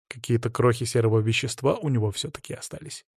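ordinary delivery, nothing marked

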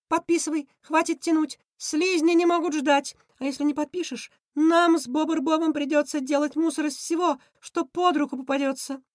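high-voiced villain pressing for a signature; high, insistent schemer; Krysion, impatient. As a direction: push them impatiently in a high cartoon voice.

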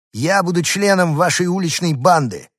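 small dog declaring he joins the gang; menacing little dog. Acted. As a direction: try to sound tough. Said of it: "грозно сказал Дуля".

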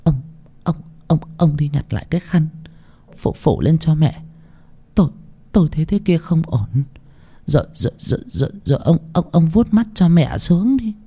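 soft, stammering, half-whispered plea; a frightened wife stuttering to her husband; trembling with fear. Acted. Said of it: "Mụ Toán cũng run rẩy".

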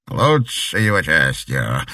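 slow, sly menacing voice; a creepy madman savouring it; sly villain. A narrator's reading. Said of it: "гадко захихикал". (медленно, гадко)

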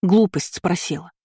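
slow, irritated retort; mildly cross comeback; snapping back. A narrator's reading. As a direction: snap back, leaning on the first word. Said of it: "огрызнулась Кейра".

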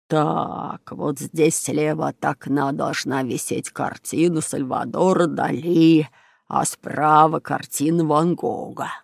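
low, slow drawl of a cartoon crook; slow, deliberate villain voice; robber Bruno scheming. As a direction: draw it out like a thief surveying the room.

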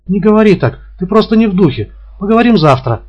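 high, loud coaxing woman; placating woman. A narrator's reading. Woman tries to calm him. (высокий громкий умиротворяющий голос)

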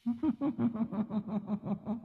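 high breathy giggle; a soft high giggle; giggling laugh. Acted. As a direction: let out a light tittering laugh. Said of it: "nhoẻn miệng cười khanh khách".

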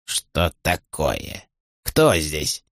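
put-on very slow, soft, drowsy old man; groggy king just woken; sleepy old king. (сонный старческий голос)

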